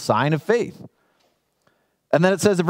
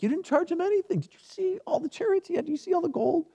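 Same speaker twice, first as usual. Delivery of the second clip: much higher, softer, whiny servant; whiny Gehazi; 12 semitones higher, 5 dB quieter than usual. Retold or imitated. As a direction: squeak it like a greedy servant. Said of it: "Gehazi's a little bummed".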